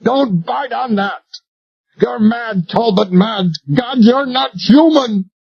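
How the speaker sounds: high, frantic, horrified; terrified pleading. Acted. Royal shrieks at Talbot holding the cyanide, horrified. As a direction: plead in horror.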